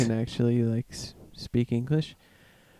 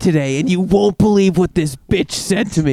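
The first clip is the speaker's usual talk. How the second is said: higher, louder ranting regular; Tim's voice; 5 semitones higher, 5 dB louder than usual. Retold or imitated. Regular ranting about the bank teller, higher and louder. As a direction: vent like an outraged regular. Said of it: "He's like".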